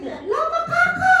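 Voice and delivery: squeaky high mock chatter; child mimicking in a high squeal. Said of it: "sabi sa akin".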